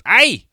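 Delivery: high, loud yelp of "Ay!"; startled "Ay!". Retold or imitated. Man hit by a shopping cart yelps "Ay!" loudly. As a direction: yelp "Ay!" like someone bumped by a cart. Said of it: "he goes".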